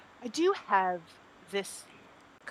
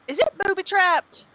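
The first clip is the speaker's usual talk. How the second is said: alarmed high question; 11 semitones higher, 10 dB louder than usual. Acted. Character nervously asking about traps.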